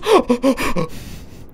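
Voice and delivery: mock horrified gasp; exaggerated, high intake of breath; shocked gasp. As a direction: act out a scandalized gasp. Acted shocked reaction of Lori.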